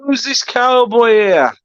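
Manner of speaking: high, loud jokey cowboy line; mock cowboy echo. Streamer answers the joking caller in a high mock cowboy voice. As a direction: answer the cowboy in his own silly voice.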